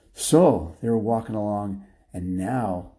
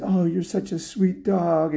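slow, high cooing; nice, doting; 9 semitones higher than usual. Acted. Lady petting her dog, slow high doting praise. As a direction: coo lovingly to a pet. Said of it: "she was so nice to the dog".

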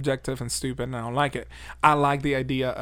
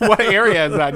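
laughing; 5 semitones higher, 10 dB louder than usual. Real, not acted. Laughing at mispronunciation.

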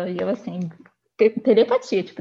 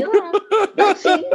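laughing; 10 semitones higher, 7 dB louder than usual. Real, not acted. Laughing at her own story.

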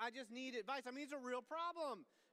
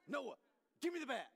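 stern dad; 3 semitones higher, 6 dB louder than usual. Shown as a demonstration. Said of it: "Stern Me".

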